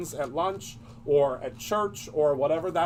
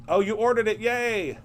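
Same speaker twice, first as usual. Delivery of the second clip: higher, louder burst of delight; happy cheer; 6 semitones higher, 4 dB louder than usual. Real, not acted. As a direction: cheer for a viewer's good news. Cheering a viewer's order.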